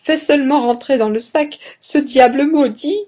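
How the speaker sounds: high-pitched, acted pleading; feeble plea. A narrator's reading. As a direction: beg helplessly, pitched well up. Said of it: "s'écria l'hôte d'une voix faible". (supplication plaintive, voix aiguë)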